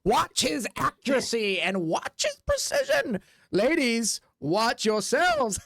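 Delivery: slow, showy big-top announcer; slow, grand circus introduction; ringleader announcer. Acted. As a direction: draw it out like a ringmaster. Ringleader introduces the knife act.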